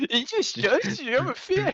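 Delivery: very high, loud laughing squeal; cracking up in a high mock voice; laughing mimicry. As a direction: mimic it through giggles. Laughing imitation of disgusted character.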